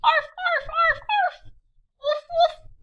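a yappy run of puppy barks. Acted. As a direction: bark like two excited pups. Two puppies barking together.

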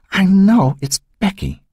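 slow, high-pitched insistence; distraught certainty. A narrator's reading. Mother insists the siren is for her daughter, slow and distraught.

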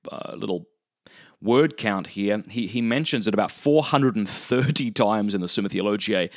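usual speaking voice

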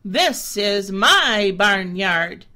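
loud, slow, with a hard push on my; a proud goat claiming his yard; boastful billy goat. A narrator's reading. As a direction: bold goat bragging. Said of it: "tossed his head and said".

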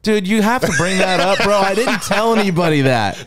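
high-pitched, mock-aggrieved complaint; embarrassed protest. Real, not acted. Embarrassed protest at being called out.

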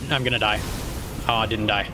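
ordinary talk

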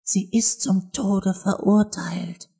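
soft, high, slow confidence; whispering rabbit. A narrator's reading. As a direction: whisper the secret slowly into an ear. (leise gewispert)

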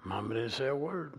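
normal talking voice

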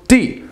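D high, falling sharply; short falling D; fourth tone. Shown as a demonstration. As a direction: say D as a sharp drop. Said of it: "fourth tone".

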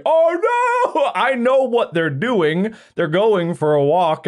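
high wail easing into slow worry; mock dog distress. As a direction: voice a dog's dismayed thoughts. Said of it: "she feels like".

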